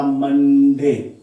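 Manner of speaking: Monday stretched out slowly as two beats; Monday in two slow, separate syllables; split into syllables. Shown as a demonstration. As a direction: draw out the first syllable of Monday, then land the second. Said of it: "मन एक बार में और डे एक बार में".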